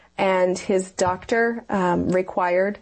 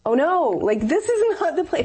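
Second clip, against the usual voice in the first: much higher, alarmed cry of dismay; dismayed surprise; 9 semitones higher than usual. Retold or imitated. Wife hearing the doctor say she's pregnant, alarmed dismay. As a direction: recreate the shock of unwelcome news. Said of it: "And I went".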